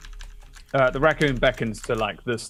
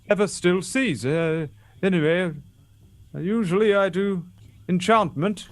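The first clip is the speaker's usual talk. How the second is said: higher-pitched, hesitant seal voice; Jack the seal; 7 semitones higher, 3 dB louder than usual. Acted. Seal back on land offers enchantment, halting and high. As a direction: ramble haltingly, pitch lifted, unsure of your use.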